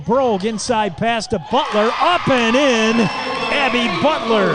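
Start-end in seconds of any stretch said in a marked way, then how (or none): 2.0-4.5 announcer shouting the score; excited call. Real, not acted. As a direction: punch the basket home. Radio call of a basket.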